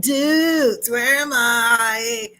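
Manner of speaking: loud, high, drawn-out California surfer dude; forced California accent. Acted. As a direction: overdo the California accent, stretching the vowels. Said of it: "trying to force a California accent".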